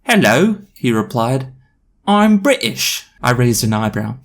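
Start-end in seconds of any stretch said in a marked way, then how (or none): 0.1-0.6 high, loud caricature British hello; put-on British accent. Mysterious boy greeting new girl, exaggerated British accent. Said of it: "someone trying to do a Russell Brand accent". 2.1-3.1 very high caricature British voice; put-on British accent. Mysterious boy introducing himself, exaggerated British accent. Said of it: "someone trying to do a Russell Brand accent".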